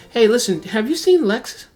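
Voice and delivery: casual probing. Acted. Player pumping the charmed chef for news, casual probing. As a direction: slip the question in like a pal.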